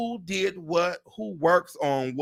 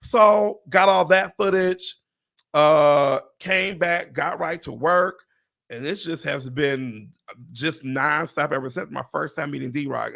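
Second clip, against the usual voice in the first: ordinary talk, nothing marked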